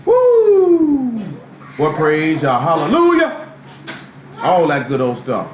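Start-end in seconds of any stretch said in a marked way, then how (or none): 0.0-1.2 high, long, loud "woo"; mock praise whoop. Mocking showy praise in church. 1.8-3.3 mock praise shouter. Mocking showy praise in church.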